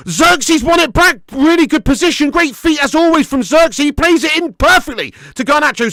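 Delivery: loud, high live play-by-play; excited commentary. Real, not acted. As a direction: call the attack as it builds.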